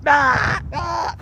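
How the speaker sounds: high mock child's shriek, "Ah! Ah!"; kid scream impression. Imitating screaming kids to the landlady, high mock shriek. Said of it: "I just did an impression of a kid".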